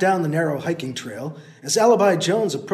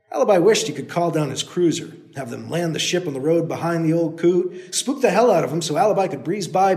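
ordinary delivery

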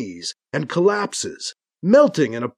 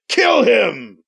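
higher-pitched villain's order, put-on voice; shouted command; 5 semitones higher, 3 dB louder than usual. A narrator's reading. Lava Lure turns to his guardsmen, shouting a villainous command. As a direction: call out a ruthless order.

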